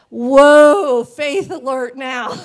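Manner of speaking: loud startled whoa, then slow and dry; blurting out a stunned whoa; mock shocked whoa. Hearing the pastor's bold plan, stunned whoa. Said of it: "I was standing there like".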